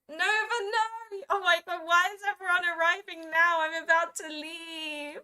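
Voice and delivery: high-pitched exasperated lament; dismayed wail. Real, not acted. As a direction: wail it in playful despair. Dismayed as viewers arrive late.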